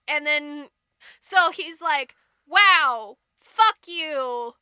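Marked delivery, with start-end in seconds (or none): 2.5-4.5 loud, punched mock indignation; spaced-out words, hammed-up anger; king cursing his wife; acted outrage.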